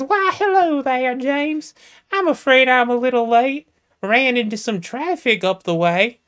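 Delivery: put-on high, prim falsetto, like a coy young lady. Jamesina speaking to James, in a high falsetto lady voice. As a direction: play a coy Southern belle up high.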